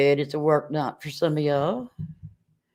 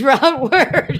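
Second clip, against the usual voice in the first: laughing reply; 10 semitones higher, 8 dB louder than usual. Retold or imitated.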